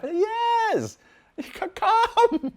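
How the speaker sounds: high, laughing mock exclamation; exclaimed yes. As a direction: cry out an emphatic, amused yes. Retold emphatic answer, laughing.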